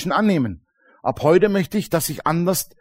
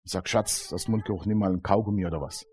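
hushed and soft, faster at the end; whispered into ear; 7 semitones lower, 10 dB quieter than usual. Acted. Preacher showing a friend kindly telling her about bad breath, softly into her ear. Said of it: "ich flüster ihr ins Ohr".

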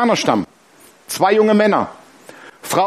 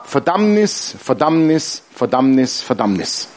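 descending, fading repetition; 5 semitones lower, slower than usual. Real, not acted. Repeated word steps down, fading.

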